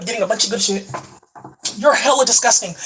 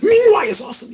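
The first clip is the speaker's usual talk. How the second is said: much higher and louder, bursting with glee; excited; 9 semitones higher, 4 dB louder than usual. Shown as a demonstration. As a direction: shout it out with a big leap up.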